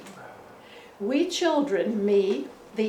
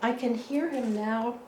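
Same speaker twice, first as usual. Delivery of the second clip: ordinary delivery, nothing marked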